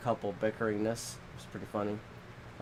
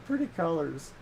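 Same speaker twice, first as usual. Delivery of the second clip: mock-delighted little kid, pitched up; toddler; 6 semitones higher than usual. Friend imitating an oblivious toddler during a disaster, mock delight. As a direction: imitate a carefree toddler marveling. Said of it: "she'll be like".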